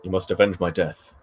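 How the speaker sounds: a hint of a grave dying father; Mufasa voice. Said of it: "Mufasa's like".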